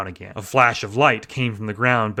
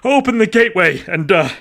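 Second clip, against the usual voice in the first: high, loud, halting grief; barely holding it together; distraught, shaken; 12 semitones higher, 6 dB louder than usual. A narrator's reading. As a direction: agent reporting his partner's loss to dispatch. Distraught agent reporting on phone.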